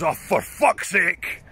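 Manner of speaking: exasperated Scotsman, drawn out; put-on Scottish groan of exasperation; Scottish accent. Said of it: "Scotsman at the next table goes".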